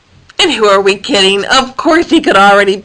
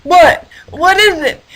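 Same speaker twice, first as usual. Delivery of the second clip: higher, louder startled what; grumpy hippo jolted awake; startled waking; 8 semitones higher, 4 dB louder than usual. Acted. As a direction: jolt awake.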